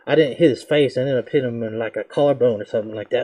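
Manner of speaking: ordinary talk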